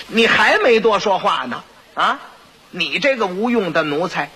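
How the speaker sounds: ordinary talk, nothing marked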